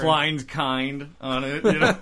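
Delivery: high, slow doctor voice; mad doctor voice. Pretend. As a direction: draw out the label proudly. Doctor reading the label.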